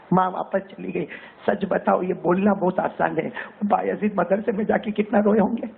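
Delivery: ordinary talk, nothing marked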